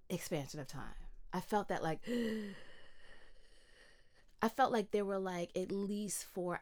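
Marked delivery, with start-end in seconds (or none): 2.0-3.4 awed gasp. Retold or imitated. Describing time expanding.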